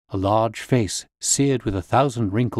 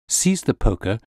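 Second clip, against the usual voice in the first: ordinary delivery, nothing marked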